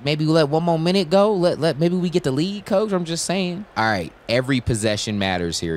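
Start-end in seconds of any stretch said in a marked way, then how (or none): 0.0-3.7 raised-pitch, eager plea; excited pleading. Real, not acted. Benched player asking coach to wait a minute, excited pleading.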